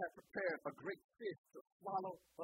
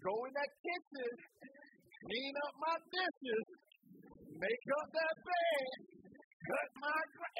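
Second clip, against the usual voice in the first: bossy parent; 5 semitones higher, slower than usual. Retold or imitated. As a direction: bark chores at a lazy child, slowly. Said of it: "You come out there".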